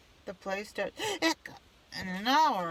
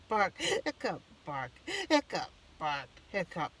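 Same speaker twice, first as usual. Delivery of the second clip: barking and hiccuping. Acted. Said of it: "Try barking".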